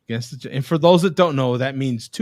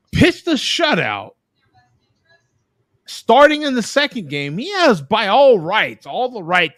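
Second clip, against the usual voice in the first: higher-pitched, punchy sports enthusiasm; emphatic amazement; 7 semitones higher, 5 dB louder than usual. Real, not acted. Fan recounting a player's doubleheader, emphatic amazement. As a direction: marvel at it, pitch lifted.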